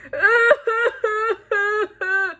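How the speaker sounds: hammed-up crying noise; mock sobbing. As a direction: wail in exaggerated, pulsing sobs. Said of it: "I just started to cry".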